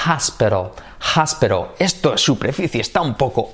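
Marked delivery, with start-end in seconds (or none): 0.1-1.7 "hospital" like Spanish "áspero" with a breathy H in front; aspirated-H HOSPITAL. Shown as a demonstration. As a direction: puff the H into "hospital", tap the T. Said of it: "Añades una H". ("hospital" como "jásperol", H aspirada)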